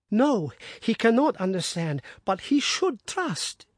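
high, pleading, wounded voice; anguished wife. Acted. Wife pleading to Watson about her husband, high and anguished. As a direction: plead the depth of her love.

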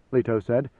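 plain reading voice

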